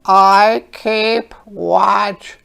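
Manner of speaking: high, slow, loud crow voice; a watchful crow calling out slowly; storybook crow keeping watch. Acted. Crow speaks in picture book.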